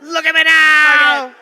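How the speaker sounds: loud, slow and gloating; brash victory shout. Pretend. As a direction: shout it like a cocky winner. Mock defiant Oscar speech.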